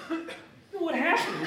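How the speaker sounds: ordinary talk, nothing marked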